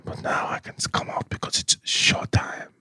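breathy whispered fragrance commercial; whispered perfume ad. Acted. Character Jaspier whispers fragrance ad.

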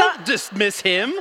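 slow, loud mock outrage; half-joking loud plea; mock shout. Defending the BLT jokingly.

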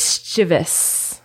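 slow three-syllable "mischievous", MIS-chiv-us; correct pronunciation. Shown as a demonstration. Quizzing partner on the next word, slow correct pronunciation. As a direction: stress the first syllable of "mischievous", no ee sound. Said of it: "It's mischievous".

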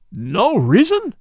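loud, high, drawn-out disbelief; incredulous cry. A narrator's reading. Commissary to an unhelpful witness, incredulous cry. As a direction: cry out slowly as an astonished question. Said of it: "cried the commissary".

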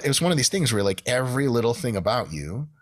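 normal talking voice

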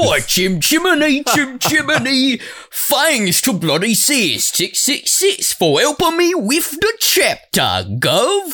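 high, slow, exaggerated wannabe Cockney. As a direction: belt out a bad Cockney chimney-sweep accent, drawn out.

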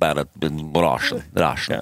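Russian accent. Shown as a demonstration. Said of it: "Russian's more like you".

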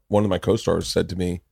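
usual speaking voice

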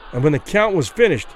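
usual speaking voice

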